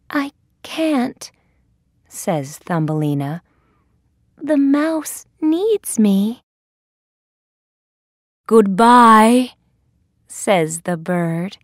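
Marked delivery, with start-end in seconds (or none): 0.1-1.3 high-pitched little heroine; Thumbelina. A narrator's reading. Turning down the bird's offer, high heroine voice. 4.4-6.4 high, slow heroine voice; Thumbelina. A narrator's reading. Explaining to the bird why she stays, high heroine voice. 8.5-9.5 loud storybook goodbye from a bird; calling farewell. A narrator's reading. Bird leaving a friend, loud farewell.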